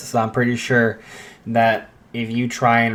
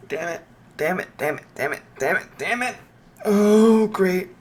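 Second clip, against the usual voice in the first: panicked muttering; 6 semitones higher than usual. Acted. Izuku panics after call ends.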